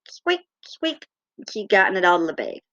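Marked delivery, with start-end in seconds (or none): 0.1-1.1 high, softer squeaks; imitated toy squeak. Cat playing with toy in shopping bag, imitated squeaks. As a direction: mimic a squeaky toy with small high peeps. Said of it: "I heard squeak".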